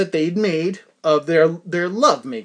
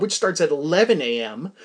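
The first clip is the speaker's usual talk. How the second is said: ordinary talk, nothing marked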